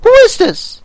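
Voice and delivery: very high, loud imperious demand; severe Queen. Acted. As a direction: bark it like a haughty queen. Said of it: "the Queen said severely".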